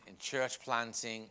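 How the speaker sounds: usual speaking voice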